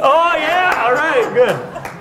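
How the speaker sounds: high, bright playful reply; playful high excitement. Real, not acted. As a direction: laugh it off playfully. Playful reply after teasing.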